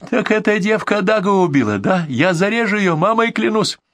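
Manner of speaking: high-pitched frantic menace; agitated threat. A narrator's reading. Bandit threatens his hostage, high and agitated. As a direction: swear vengeance in a high rant. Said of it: "кричал нервно Кацо".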